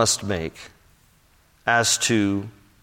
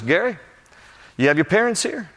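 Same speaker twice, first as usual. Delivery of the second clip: lightly voiced coach, pitched up; coach's voice; 6 semitones higher than usual. Coach approaching a player by the bus, lightly acted coach voice. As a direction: put a question to the player as his coach.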